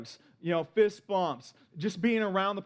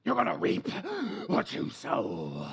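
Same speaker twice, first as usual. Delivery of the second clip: grandma's ominous warning. Retold or imitated. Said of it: "she would say it like this".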